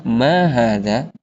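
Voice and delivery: ma hadza said slowly, rising at the end; ma hadza with a clear questioning lift; question tune. Shown as a demonstration. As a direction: ask ma hadza as a real question. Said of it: "Jadi kita bertanya".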